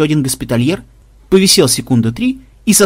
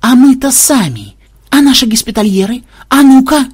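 high, manic realization, growing louder; paranoid excitement; 10 semitones higher, 4 dB louder than usual. A narrator's reading. Programmer suspects his own team, paranoid excitement. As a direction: seize on the idea with rising paranoid zeal. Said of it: "снова оскалился в параноидальной улыбке".

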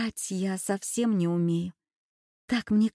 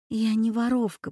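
plain reading voice

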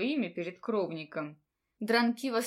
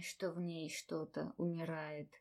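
ordinary delivery

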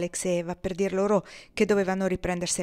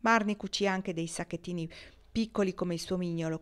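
ordinary delivery

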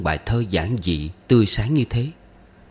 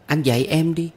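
put-on high, wheedling child voice; small girl demanding a lesson; pouting little girl; 8 semitones higher than usual. A narrator's reading. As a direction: beg with a pout, pitched up. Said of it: "Con bé phụng phịu bắt tôi dạy bài Con Cò".

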